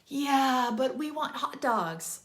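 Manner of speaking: pushy, slow, high-pitched customer plea; insistent customer. Acted. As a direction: play the pleading diner, stretching it out slowly. Acted whiny customers demanding hot dogs.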